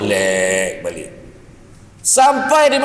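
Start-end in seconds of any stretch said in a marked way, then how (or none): 0.0-1.1 slowed down. Acted. Slowing down, relaxed.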